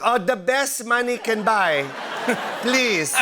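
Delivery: mom's voice. Retold or imitated. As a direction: pose as your mom ordering by phone. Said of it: "I used my mom's voice".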